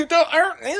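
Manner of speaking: high, loud, panicky squeal; hammed-up shrieking plea; mock screaming. Jerk being beaten up, mock screaming plea.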